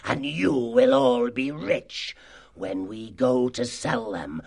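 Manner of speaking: grandiose villain speech to his gang; sly, self-glorying fox chief; sly boasting. Acted. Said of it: "egged them on with his sly tongue".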